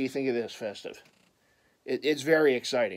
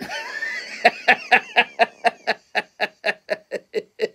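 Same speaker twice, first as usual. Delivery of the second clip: long cackling laugh; 7 semitones higher than usual. Real, not acted. Laughs at own Cleveland jibe.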